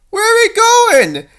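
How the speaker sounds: high, loud question; anxious shout. Acted. Fleeing princess shouts to her rescuer. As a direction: shout while running.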